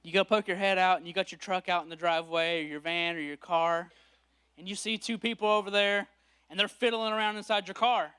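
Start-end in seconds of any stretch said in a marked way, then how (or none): none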